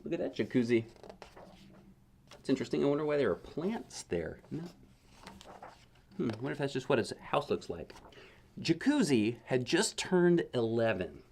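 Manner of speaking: plain reading voice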